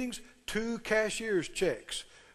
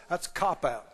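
ordinary talk

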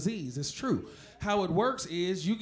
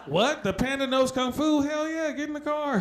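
put-on a dad suddenly pumped for a kids' movie, pitched higher; excited dad; 6 semitones higher, 5 dB louder than usual. Dad reacting to a kung fu panda movie, pitched-up excitement. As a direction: blurt it out like a thrilled father.

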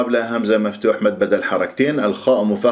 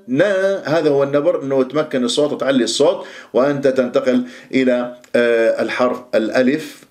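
ordinary talk, nothing marked

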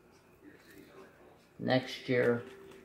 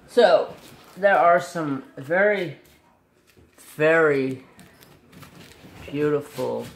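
excited; 2 semitones higher, 9 dB louder than usual. Real, not acted. Enthusing about colors.

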